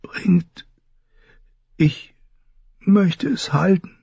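high, breathless whispered plea; dazed whisper. A narrator's reading. Drowsy Dany asks the Maegi for the egg, whispered. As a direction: whisper it half asleep, drugged. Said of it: "flüsterte sie benommen".

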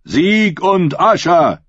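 drawn-out acclaiming call. A narrator's reading. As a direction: cheer it out to the crowd. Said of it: "rief Rodrik der Leser".

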